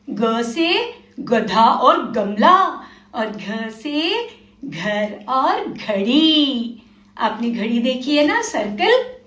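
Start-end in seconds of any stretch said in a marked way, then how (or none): 0.1-6.8 put-on very slow, each word spelled out for children; slow modelled reading. Shown as a demonstration. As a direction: read it slowly so kids can repeat. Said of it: "चलो मेरे साथ बोलना।".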